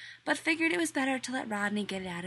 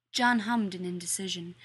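ordinary talk, nothing marked

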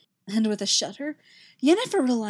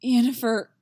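uneven voice; 3 semitones higher, 6 dB louder than usual. Acted. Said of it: "his voice just as uneven".

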